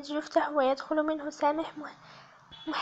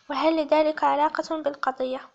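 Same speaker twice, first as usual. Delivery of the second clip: ordinary delivery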